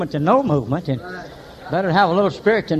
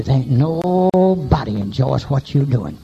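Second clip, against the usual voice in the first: devil's voice; 4 semitones lower, slower than usual. Retold or imitated. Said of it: "Devil tell you".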